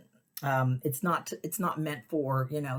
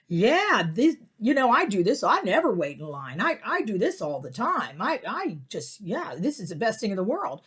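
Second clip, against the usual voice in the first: higher, louder bragging drunk imitation; boastful drunk guy; 4 semitones higher, 4 dB louder than usual. Imitating the line-cutting drunk bragging, loud. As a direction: ham up the loudmouth bragging.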